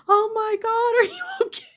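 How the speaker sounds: high-pitched mock-panicked concern; retold alarm. Honker running over to the fallen woman, mock-alarmed concern.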